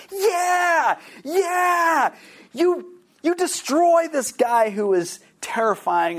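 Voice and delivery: high, loud excited egging-on; gleeful cheering. Retold or imitated. Part of her cheering the chainsaw attack, high and loud. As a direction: cheer the avenger on with glee. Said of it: "There was a part of me that was like".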